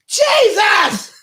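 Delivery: high shrill-free yell across the party; drunk mom yelling. Acted. Drunk Mary calling Jesus across the party, yelling. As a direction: call her son loudly from afar.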